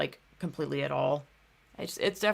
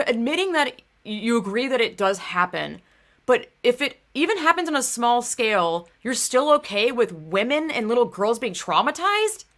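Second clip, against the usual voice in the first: exasperated rant; 6 semitones higher, 6 dB louder than usual. Retold or imitated. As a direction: build to a loud, disbelieving demand. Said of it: "And I'm like".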